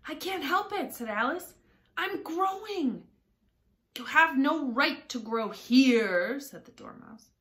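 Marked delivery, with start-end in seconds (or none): 0.1-0.9 put-on much higher girlish protest; high-pitched, put-on Alice voice. A narrator's reading. 2.0-3.1 put-on high, drawn-out girlish explanation; slow, high-pitched Alice voice; protesting Alice. A narrator's reading. 4.0-6.4 louder, slow, higher scolding; affronted, raised-voice complaint; indignant Dormouse. A narrator's reading.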